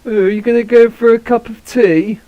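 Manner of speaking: high-pitched posh English caricature; mock posh British. Mimicking how Americans impersonate the British, mock posh British. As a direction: put on an exaggerated posh British voice. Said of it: "That's how the Americans impersonate us".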